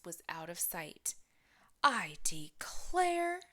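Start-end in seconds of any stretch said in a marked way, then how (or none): 1.8-3.4 slow, high, whispered southern-lady exclaim; Mama Bear exclaiming. A narrator's reading. Mama Bear watches the girl run off, slow and high. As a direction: stretch it slowly in dismay. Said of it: "Mama Bear exclaimed".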